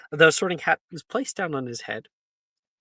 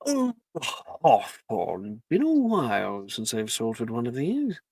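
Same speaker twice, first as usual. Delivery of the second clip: inner Sorting Hat voice; 4 semitones lower, slower than usual. Acted. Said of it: "you hear a voice... in your mind".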